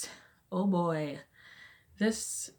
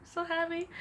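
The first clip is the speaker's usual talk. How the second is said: excited; 12 semitones higher than usual. Real, not acted. Announcing baby girl news.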